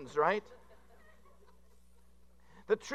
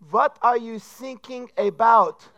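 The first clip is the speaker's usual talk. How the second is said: slow, higher, louder voice of a puzzled trainee; Coast Guard trainee; 5 semitones higher, 10 dB louder, much slower than usual. Acted. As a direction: ask it slowly like a confused operator. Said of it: "and he finally says".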